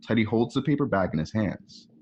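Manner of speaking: ordinary talk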